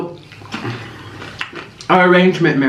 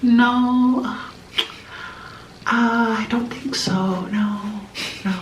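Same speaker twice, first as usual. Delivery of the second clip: high, soft, drawn-out reluctance; Kathy impression; 7 semitones higher, 6 dB quieter, much slower than usual. English guest answering the healer about the rock's energy, high hesitant mimicry. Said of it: "Kathy goes".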